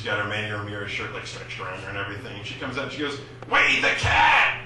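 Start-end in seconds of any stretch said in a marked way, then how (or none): 3.5-4.6 loud, slow, higher Boston yell of wait for the cab; Boston accent shout. Retold or imitated. A big Boston woman outside Fenway yells in a thick Boston accent. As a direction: bellow it in thick Boston English, slowly. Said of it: "Boston English".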